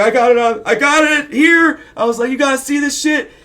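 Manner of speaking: put-on a kid squealing to his parents to look; squeaky excited. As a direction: show it off, voice cracking high. Kid insists he has photos.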